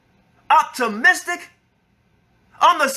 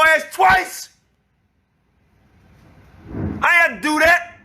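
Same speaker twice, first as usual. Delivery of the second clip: angry shouting; 6 semitones higher than usual. Real, not acted. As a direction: yell it, full of grievance. Confronting Yakutas over past help.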